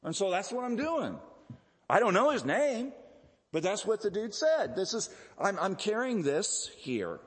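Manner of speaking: high-pitched, casual imitation of a man defending himself; acted simple fellow, voice pitched well up; healed man explaining. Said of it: "he relates".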